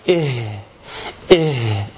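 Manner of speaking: low إيه pushed out into a long breathed h; effortful ha. Shown as a demonstration. Teacher labouring so the letter comes out, strong ha. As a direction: work hard to sound the h ending إيه. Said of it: "بذلت مجهود في الحرف وتعبت".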